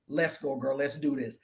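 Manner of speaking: low, soft self-talk; inner pep talk. Retold or imitated. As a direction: retell it as a quiet nudge to yourself. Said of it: "Something in me was like".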